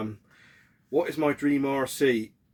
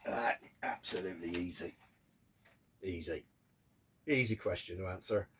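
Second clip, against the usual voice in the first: low and soft; 5 semitones lower, 10 dB quieter than usual. Real, not acted. Emphatic easy answer, dropped voice.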